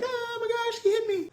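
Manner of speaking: put-on very high, wailing little-kid cry; mock crying. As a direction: wail like a hurt little sister, hammed up. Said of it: "she's crying, holding her arm like".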